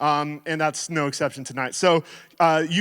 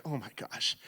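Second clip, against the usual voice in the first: much softer, tired oh my gosh; weary sigh; 3 semitones lower, 13 dB quieter than usual. Retold or imitated. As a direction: mutter it under your breath, defeated. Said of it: "I'm like".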